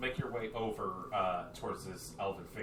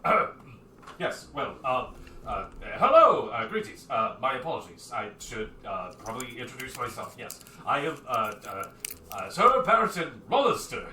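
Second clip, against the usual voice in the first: awkward, halting formal introduction; flabbergasted; 7 dB louder than usual. Acted. Armored man introduces himself to approaching stranger, flabbergasted. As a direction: fumble through polite greetings, throat-clearing and stumbling.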